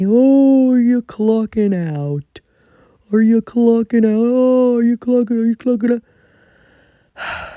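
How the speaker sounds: loud, high mocking voice of nosy coworkers. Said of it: "people always say to me".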